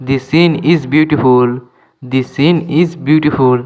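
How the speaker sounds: slow, clear English for learners; careful dictation pace; slow teaching demo. Shown as a demonstration. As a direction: spell each word out slowly for students. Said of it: "teacher repeats example slowly".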